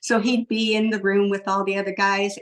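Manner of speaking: ordinary talk, nothing marked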